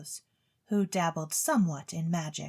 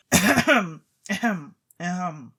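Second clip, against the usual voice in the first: pointed throat clearing; 8 dB louder than usual. Acted. Chemist hints at payment.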